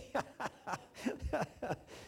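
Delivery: high, breathy laugh at himself; chuckling at himself; real laugh. Real, not acted. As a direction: laugh at your own foolishness. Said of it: "Oh, yesterday".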